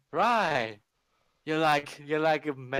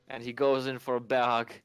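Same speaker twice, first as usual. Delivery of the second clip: ordinary talk, nothing marked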